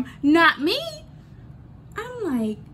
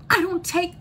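ordinary talk, nothing marked

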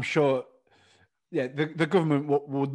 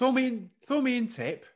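put-on higher posh Northern accent; 9 semitones higher than usual. Mocking his friend's posh Northern accent on the tip line. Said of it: "it's a posh Northern accent".

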